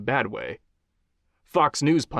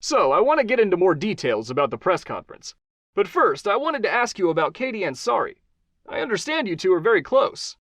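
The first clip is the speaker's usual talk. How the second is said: higher, upbeat host voice; talk-show host Johnny; 9 semitones higher than usual. Acted. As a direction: charm the guest as a TV host. Host asks question.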